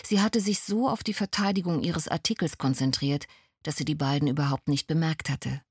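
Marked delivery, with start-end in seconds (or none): none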